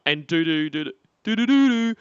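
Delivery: ordinary talk, nothing marked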